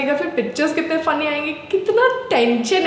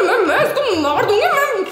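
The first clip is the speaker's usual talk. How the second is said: high-pitched, worked-up rant; comic exasperation; 8 semitones higher than usual. Acted. Bride fed up with heat in wedding clothes, exasperated. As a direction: let the irritation climb higher.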